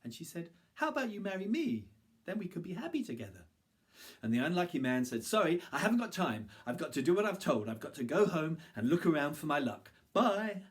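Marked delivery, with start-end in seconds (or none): 0.7-3.4 woman proposing. Acted. 5.2-10.6 hurried. Acted. Hurried refusal.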